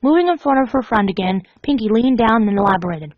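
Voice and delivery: ordinary delivery, nothing marked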